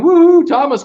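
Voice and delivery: reading a viewer's cheer aloud; mock cheer. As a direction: cheer it out like a fan. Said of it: "Sheila's going".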